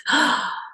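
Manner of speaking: sharp, high gasp of recognition. Retold or imitated. Remembering the boy she saw at fourteen, a gasp. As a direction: gasp as the memory hits. Sudden recognition in retold meeting.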